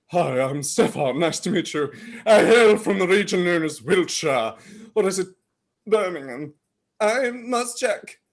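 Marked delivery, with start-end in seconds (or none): 0.1-6.5 lower-pitched put-on Wiltshire voice; mock Wiltshire accent. Practising a home region for an alias, low Wiltshire accent. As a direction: introduce yourself in a low English regional accent. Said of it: "practice my range of accents".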